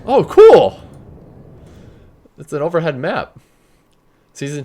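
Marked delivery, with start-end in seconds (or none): 0.0-0.8 loud, high burst of delight; thrilled at what pops up on screen; excited delight. Real, not acted. Player spots the overhead map, excited and loud.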